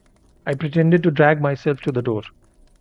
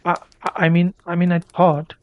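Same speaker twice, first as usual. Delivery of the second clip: halting, repeated I and I mean, pitched higher; flustered stammer, a little higher; stuttering; 4 semitones higher than usual. A narrator's reading. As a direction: trip over the words, caught off guard. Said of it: "I stuttered".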